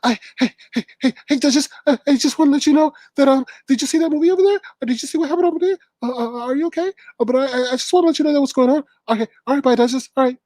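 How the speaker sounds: mock her as a fake-sweet gossip checking in; imitating Nikki Blue. Mocking Nikki Blue calling in on Duchess's panel, high fast put-on voice. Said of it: "that's the one that's always coming on Duchess".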